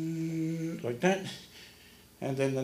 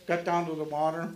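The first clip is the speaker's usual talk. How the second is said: ordinary talk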